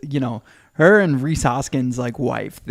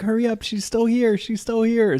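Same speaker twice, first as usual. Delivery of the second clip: excited; 9 semitones higher than usual. Retold or imitated. Families rushing, quoted.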